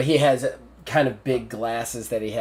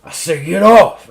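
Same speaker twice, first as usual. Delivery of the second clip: much louder, higher repeated command; angry shouted order; 6 semitones higher, 14 dB louder than usual. Acted. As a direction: shout it as a furious general losing patience. Repeats order, shoves doctor off.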